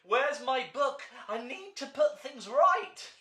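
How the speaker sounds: high, loud, urgent pleading; frantic to fix the mess; panicked Monty. Acted. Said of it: "gasped Monty".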